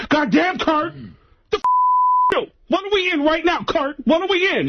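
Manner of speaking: high-pitched exasperated impression, mock angry; pissed-off Tracy Morgan. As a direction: demand answers in a high, put-on comic voice. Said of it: "Tracy got pissed and pulled the car over".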